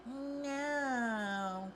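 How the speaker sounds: high, curt mock refusal; a prissy staffer's flat no, mimicked high; mock lounge staff. Mocking the lounge staff who turn her away, high and curt. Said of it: "And they're like".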